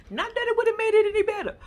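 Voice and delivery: high, loud laughing through words. Real, not acted. Laughing at own story.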